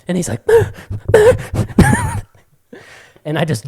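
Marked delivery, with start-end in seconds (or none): 0.5-2.2 very high, loud yelps; imitated dog whimper. Puppy answering his yelling owner, imitated yelps. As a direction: squeal like a struggling puppy.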